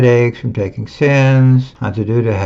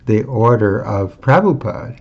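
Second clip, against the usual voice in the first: ordinary delivery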